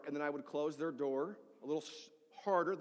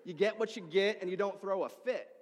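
firm, louder, higher parental scolding; parent to kids; 5 semitones higher, 5 dB louder than usual. Retold or imitated. Pastor quoting what he tells his kids, firm scolding. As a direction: lay down the rule like a parent. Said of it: "what I would say to my kids".

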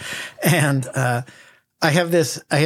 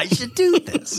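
much higher, mocking echo of the line; 11 semitones higher than usual. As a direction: parrot him back in a high silly voice. Mocking partner's musing.

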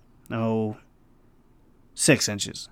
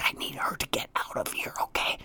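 voiceless mouthed words; mouthing behind a mask to the chef; mouthed silently; 13 dB quieter than usual. Retold or imitated. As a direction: mouth it without any voice. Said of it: "I'm, like, mouthing".

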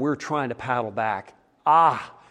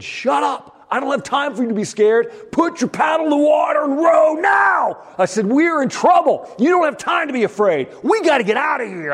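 retold panicked shout, much higher and louder; yelling at son; 11 semitones higher, 3 dB louder than usual. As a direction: bark orders at a scared son in a storm. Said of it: "I'm yelling at my son".

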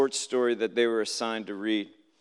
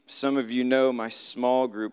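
ordinary talk, nothing marked

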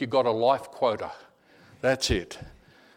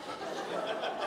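big mock gasp of shock; 13 dB quieter than usual. Man suddenly seeing who came looking for him, mock shocked gasp. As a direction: suck air in, imitating sudden shock. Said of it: "he was just shocked".

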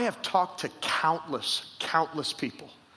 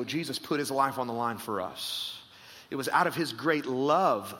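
usual speaking voice

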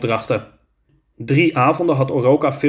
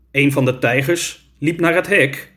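plain reading voice

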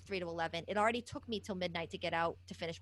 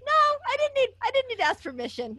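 high, loud laughing reply; laughing mock defiance; laughing retort; 6 semitones higher, 9 dB louder than usual. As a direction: laugh out the answer.

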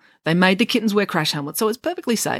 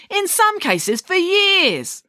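much higher, very slow scornful drawl; contemptuous; 13 semitones higher, much slower than usual. Acted. Chelly sneers at how long they practised, slow and contemptuous. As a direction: stretch out the scorn. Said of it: "said Chelly contemptuously".

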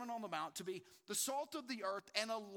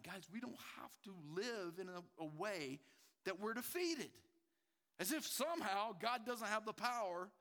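normal talking voice

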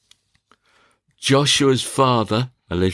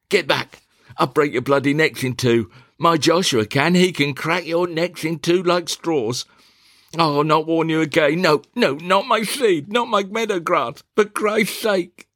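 fending off swans; 7 semitones higher than usual. Acted. Alicia fends off advancing swans.

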